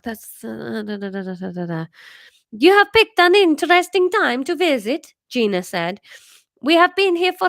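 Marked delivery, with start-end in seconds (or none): 2.5-5.1 high, loud Italian mother; Italian accent. Acted. 6.6-7.5 high, loud Italian mother; Italian accent. Acted.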